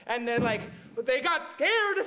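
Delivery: ordinary delivery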